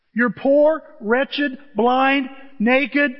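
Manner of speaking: each word punched loud and high; scornful preaching list; loud mock list. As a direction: hammer out the list like an accusation. Mocking list of church's state.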